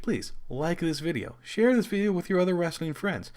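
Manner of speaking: usual speaking voice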